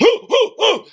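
loud, high hooting, rising each time; high hooting ooh. Retold or imitated. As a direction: hoot like a party guy at a bar. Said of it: "he's like".

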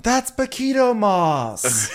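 high slow punchline. Acted. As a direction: drag the punchline out. Joke punchline.

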